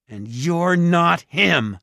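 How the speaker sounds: high, loud and slow; angry accusation. A narrator's reading. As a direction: hurl the accusation word by word. Jared confronts the disguised ogre.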